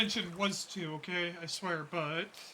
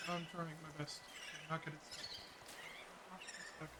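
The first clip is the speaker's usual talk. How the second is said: quiet muttering to self; softer, muttering under breath while straining; 3 semitones lower, 12 dB quieter, faster than usual. Real, not acted. Struggling with a stuck desk.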